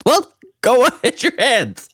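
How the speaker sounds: high, laughing parental command; laughing order. Retold or imitated. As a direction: order the kid while cracking up.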